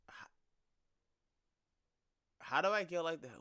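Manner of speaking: ordinary talk, nothing marked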